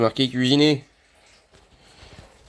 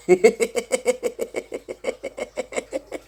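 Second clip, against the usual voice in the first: high laugh; 12 semitones higher than usual. Real, not acted. Laughing at the heat.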